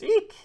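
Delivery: very high, loud little eek; startled squeal. Acted. Retelling the moment the baby is taken, high-pitched eek. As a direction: squeak out a sudden comic squeal.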